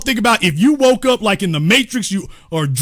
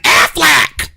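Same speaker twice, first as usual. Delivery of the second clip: higher, louder duck cry of Aflac; Aflac duck squawk; 6 semitones higher, 4 dB louder than usual. Acted. The insurance duck seeing the fall, squawking Aflac. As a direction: squawk the brand name like the cartoon duck. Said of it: "they like".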